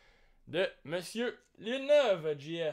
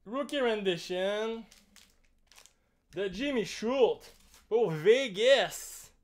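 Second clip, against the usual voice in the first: hyped card reveal; 4 semitones higher, 5 dB louder, slower than usual. Real, not acted.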